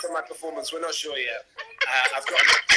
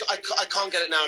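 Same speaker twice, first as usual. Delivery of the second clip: ordinary talk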